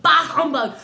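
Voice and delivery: hammed-up Scrooge shout; mock grumpy outburst. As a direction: bellow it as a comic miser. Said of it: "That's what he always says".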